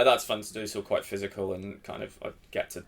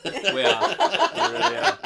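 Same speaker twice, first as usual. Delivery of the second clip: high, loud, hammed-up thankfulness; mock gratitude; 11 dB louder than usual. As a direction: gush your thanks much higher, half-laughing.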